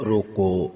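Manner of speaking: "روکو" low and set apart, then stop; quiet, isolated روکو closed with a pause; breath pause after روکو. Shown as a demonstration. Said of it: "اتھے سا کڈیا".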